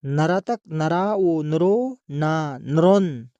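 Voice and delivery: slow teacher's spelling out of नरतक नरा ओ नरो ना नरोन; slow, syllable by syllable. Shown as a demonstration. Teacher reading नरतक नरा ओ नरो ना नरोन slowly to pupils, syllable by syllable. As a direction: draw out each syllable of नरोन for the class. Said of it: "teacher spelling out syllables".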